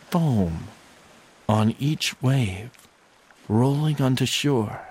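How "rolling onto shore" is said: plain reading voice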